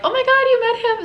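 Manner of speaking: high, loud excited gasp of a fan; mock fan squeal. Followers replying to her story, squealing with excitement. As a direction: gush like a starstruck follower.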